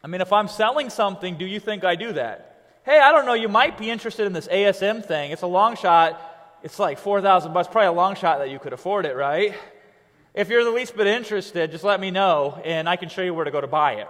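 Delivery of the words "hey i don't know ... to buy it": loud, pushy sales pitch; pushy salesy. Shown as a demonstration. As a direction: hammer the long shot like a bad salesman. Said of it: "You don't sell like that".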